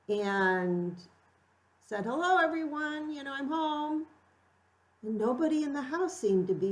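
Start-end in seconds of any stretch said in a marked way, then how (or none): 2.0-4.1 cheery calling out. Retold or imitated. Calling out on coming home.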